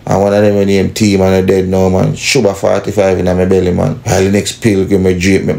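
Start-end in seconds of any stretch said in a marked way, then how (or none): none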